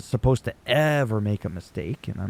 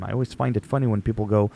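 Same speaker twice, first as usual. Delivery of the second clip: ordinary delivery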